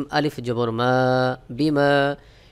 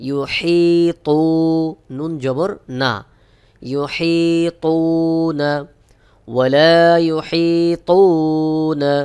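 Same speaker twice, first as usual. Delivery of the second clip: slow chanted recitation; teacher models the verse in slow tajweed; Quranic recitation; 3 semitones higher, 6 dB louder, much slower than usual. Shown as a demonstration. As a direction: recite it slowly and melodiously.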